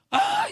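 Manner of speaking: un «¡ay!» agudísimo y fuerte, imitando el golpe; grito de dolor fingido. Retold or imitated. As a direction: chilla como quien recibe un porrazo en la cabeza. Woman struck on head cries out. (grito agudo de la hermana al recibir el golpe)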